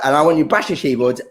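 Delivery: ordinary talk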